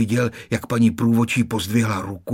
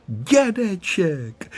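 joyful cry; 6 semitones higher, much slower than usual. A narrator's reading. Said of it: "vykřikl Honzík a zaradoval se".

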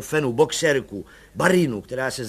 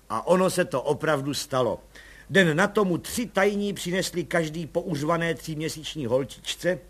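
ordinary delivery, nothing marked

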